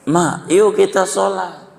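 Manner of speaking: yuk kita sholat pitched high like a small calf; little calf's plea. Acted. Calf begs its mother to pray, high childlike voice. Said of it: "Begitu kata anak sapi".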